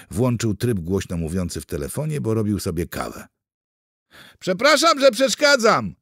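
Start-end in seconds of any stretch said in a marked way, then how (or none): 4.4-5.9 high, loud call across the kitchen; shouting. A narrator's reading. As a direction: shout it towards the speakerphone. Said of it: "krzyczał z nadelektrycznego czajnika". (głośne wołanie z kuchni)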